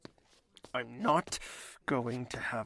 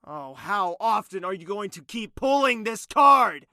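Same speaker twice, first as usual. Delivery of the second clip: exasperated outburst; 6 semitones higher, 12 dB louder than usual. Acted. Exasperated reply in quarrel.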